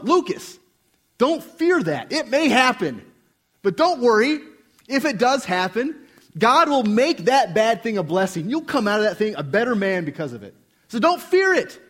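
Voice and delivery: high, loud preaching to himself; fervent self-exhortation. Retold or imitated. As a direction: urge yourself on like a fiery preacher. Preacher urging himself, full force.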